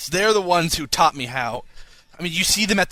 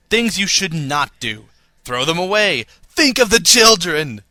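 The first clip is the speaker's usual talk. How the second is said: building louder to a mock-alarmed cry; melodramatic plea; 7 dB louder than usual. Host hams up the listener's warning, building to loud mock alarm. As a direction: plead like it's a public safety ad. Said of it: "I put the emotion in there".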